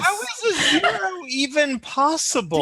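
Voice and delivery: high-pitched real disbelief with laughter; incredulous outburst. Real, not acted. Player reacts to an impossible zero roll, incredulous. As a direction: cry out, baffled and half laughing.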